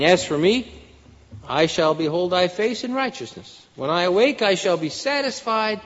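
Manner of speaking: lifted, declaiming verse; scripture reading. Acted. Preacher reading verse fifteen, lifted declaiming. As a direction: proclaim the verse to the room.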